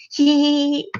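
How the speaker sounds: high mocking giggle; creepy giggle. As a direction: giggle like a gleeful villain. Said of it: "Twilight broke the somber silence".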